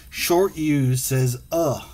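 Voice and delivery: "uh" said as a clipped short u; teacher sounding out the short u. Shown as a demonstration. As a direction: model the short vowel "uh" for the class.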